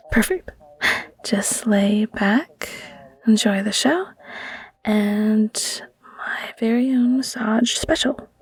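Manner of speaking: gentle caring whisper to a sick partner; tender bedside whisper, unhurried. Acted. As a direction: whisper close and soothing. Caring ASMR whisper roleplay.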